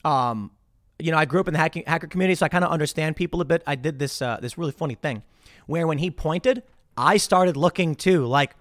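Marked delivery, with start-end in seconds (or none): none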